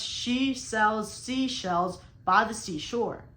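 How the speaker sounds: slow, deliberate, every word pronounced clearly; slow careful tongue twister. Shown as a demonstration. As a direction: say it slowly so each sound lands right. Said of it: "let's say I'm trying to pronounce".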